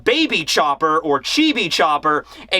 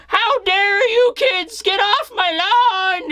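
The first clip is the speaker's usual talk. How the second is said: high, slow get-off-my-lawn geezer; grumpy old-timer yelling at kids; cranky old man; 13 semitones higher, slower than usual. Retold or imitated.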